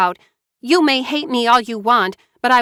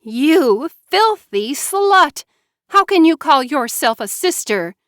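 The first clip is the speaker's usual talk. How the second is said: venomous; 7 semitones higher, 4 dB louder than usual. A narrator's reading. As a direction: spit the insult with venom. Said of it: "the venom in her voice".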